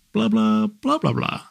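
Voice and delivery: high, sing-song blah blah; imitating his wife's high chatter; a playful high-pitched babble; mock high blah. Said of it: "other days she'll go".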